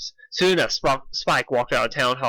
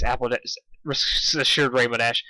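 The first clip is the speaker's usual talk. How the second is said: ordinary delivery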